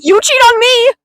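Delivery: high, loud playful accusation; mock outrage. Friend accusing their partner in a joke quarrel, mock outrage. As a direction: burst out as if scandalised.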